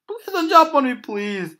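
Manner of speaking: high, loud frightened pleading; jump scare laugh. Real, not acted. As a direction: beg in real fear. Begging the animatronic.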